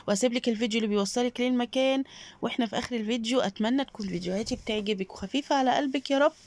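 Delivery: usual speaking voice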